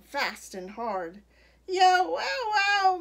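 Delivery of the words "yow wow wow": loud, high yowl of pain; hammed-up storybook wail. A narrator's reading. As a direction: howl it out, long and loud. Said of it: "Missus McSnoot wailed".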